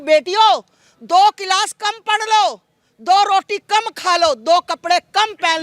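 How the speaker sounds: slow, weighty words, each phrase pressed home; slow emphatic appeal. Real, not acted. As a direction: lay it out slowly like a solemn warning to daughters. Appeal to daughters via the channel. (धीमी, ज़ोरदार appeal)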